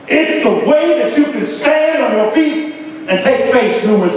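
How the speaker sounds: stirring spoken poem, building in pitch; poem recitation. Acted. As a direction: declaim it like a call to keep fighting.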